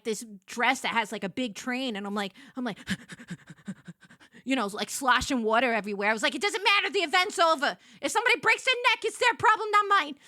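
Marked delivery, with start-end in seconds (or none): 6.3-10.1 breezy defiance. Retold or imitated. Careless defiance while fleeing.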